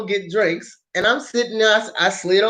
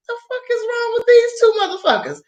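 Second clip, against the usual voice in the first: high, incredulous outrage; exasperated disbelief. Retold or imitated. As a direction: scoff it in disbelief. Exasperated at the strangers.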